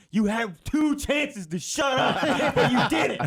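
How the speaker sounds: very high, drawn-out scolding through laughter; mock scolding boyfriend. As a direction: lecture her in a hammed-up high voice, cracking up. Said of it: "boyfriend's gonna be talking to her like".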